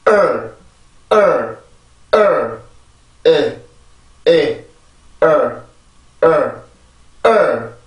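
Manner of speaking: short drilled vowels with a falling tune. Shown as a demonstration. Teacher leading a vowel drill, each sound short and falling. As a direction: clip each vowel and let it drop. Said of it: "읽어봅시다".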